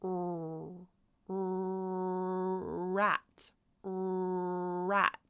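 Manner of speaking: "er" held, then "rat" with a long R; stretched R sound. Shown as a demonstration. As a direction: hold the R and slide into "rat". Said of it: "is pronounced".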